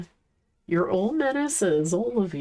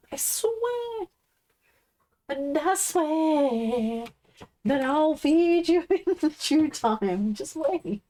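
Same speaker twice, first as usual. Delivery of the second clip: much higher, slow sing-song pet voice; 7 semitones higher, slower than usual. Real, not acted. Streamer promising her pushy dog food soon, in high baby talk. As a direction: coo a slow promise to the puppy.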